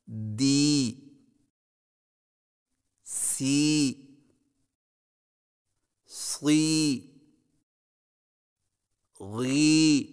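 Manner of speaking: each syllable alone with a long ee; drill syllables. Shown as a demonstration. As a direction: say each syllable slowly with a pure ee. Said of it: "صحیح تلفظ کے ساتھ ادا کیجیے". (الگ الگ لمبی یا معروف کے ساتھ)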